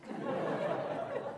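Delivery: breathy high laugh. Real, not acted. Preacher laughs at his own story, real laugh. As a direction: laugh at the joke.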